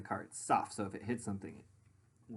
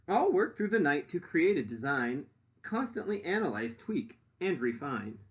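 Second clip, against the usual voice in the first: lively rhyming storybook read; reading to children; 4 dB louder than usual. Acted. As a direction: read it out to the class with bounce. Reads picture book aloud.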